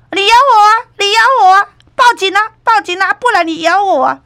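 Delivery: taunting dare. Retold or imitated. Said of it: "稍微搁甲电话过来讲".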